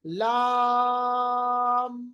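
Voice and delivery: lam held very long, chanted slowly; drawn-out lam. Shown as a demonstration. Teacher demonstrating لام, slowly drawn out. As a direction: stretch لام, like a recitation demo. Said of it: "تو کیسے پڑھیں گے؟".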